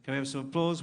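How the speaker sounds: ordinary delivery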